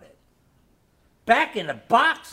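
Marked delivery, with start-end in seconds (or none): 1.3-2.3 wailing. A narrator's reading. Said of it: "I wailed".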